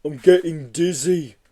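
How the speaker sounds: slow, woozy beast voice; sway through it like you're spinning; dizzy Yeti. Acted. Yeti spinning in revolving doors, slow woozy voice.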